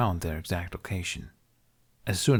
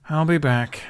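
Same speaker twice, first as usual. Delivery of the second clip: louder, higher call over the shoulder; calling back; 7 semitones higher, 5 dB louder than usual. A narrator's reading. Walking off, Hiccup calls back to his dragon. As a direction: call it out as you walk off. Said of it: "he called behind him".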